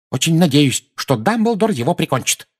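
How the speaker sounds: put-on high old woman's wrath; high-pitched fierce outburst; furious exclamation. A narrator's reading. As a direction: spit it out vengefully. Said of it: "яростно воскликнула миссис Фиг".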